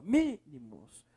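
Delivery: "Mínimos" slowly, with a held MÍ; stressed syllable. Shown as a demonstration. Teacher answering which syllable is strongest, stress on MÍ in "Mínimos". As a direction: stretch the first syllable of "Mínimos". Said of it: "Aí é o mi".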